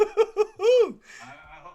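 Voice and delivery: stretched, high, laughing slowly; can't get it out for laughing. Real, not acted. Caught out by his friend's trading joke, laughing.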